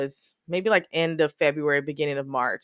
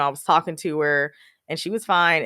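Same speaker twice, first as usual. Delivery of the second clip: normal talking voice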